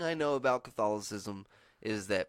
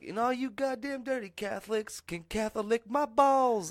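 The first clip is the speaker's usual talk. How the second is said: mock bigot rant; 11 semitones higher, 3 dB louder than usual. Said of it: "he's all like".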